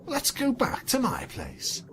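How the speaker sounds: smiling invitation. Acted. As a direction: coax her home sweetly. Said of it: "she smiled".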